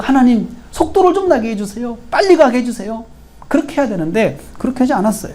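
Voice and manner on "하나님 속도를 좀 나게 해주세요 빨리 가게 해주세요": higher-pitched, urgent mock prayer; impatient plea. Preacher voices Paul's imagined prayer to go faster, impatient plea. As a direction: plead like someone in a hurry. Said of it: "마음에 조급해가지고".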